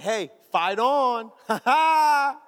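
slow, high, loud hurrah ending in a laugh; gleeful rallying cry. Retold or imitated. Man showing his wife the fingers he grabbed, gleeful cry. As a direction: crow it out slowly with a big mock laugh.

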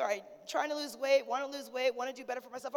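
ordinary talk, nothing marked

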